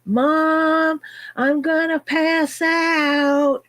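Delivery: put-on high, slow little-kid plea to mom; lightly voiced scared child, slow and high. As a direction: voice a small child telling mom she'll faint. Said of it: "as a five, six-year-old".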